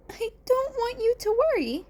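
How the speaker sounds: put-on high, strained, teary boy's voice; nervous teen boy. Izuku thanking his teachers while fighting back tears. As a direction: play the hurt kid fumbling his thanks.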